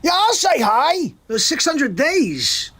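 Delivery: high-pitched voice of a guest chiming in; Artie. Acted. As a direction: pitch it up for a put-on caller voice. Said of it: "Hey, Artie".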